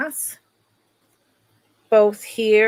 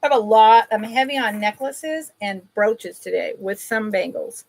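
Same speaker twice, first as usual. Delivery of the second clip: normal talking voice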